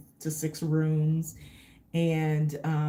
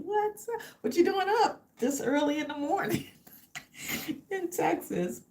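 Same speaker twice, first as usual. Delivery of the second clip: high, quick happy hello; a bright call to an early viewer; real delight greeting a viewer; excited greeting; 11 semitones higher than usual. Real, not acted.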